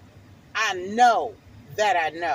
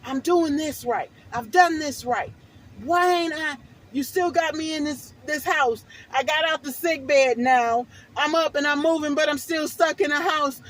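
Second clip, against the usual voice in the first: rising, louder desperate plea; crying out to God; 5 semitones higher, 5 dB louder than usual. Retold or imitated. Retelling her prayer to God, pleading louder and higher. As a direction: build from pleading to a loud cry. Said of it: "I'm crying out to God".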